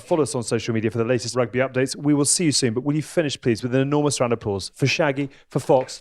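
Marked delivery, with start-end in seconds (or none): none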